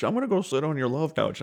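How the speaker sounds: high-pitched mock kid voice; imitated child. Dad quoting his child about the couch, high imitated voice.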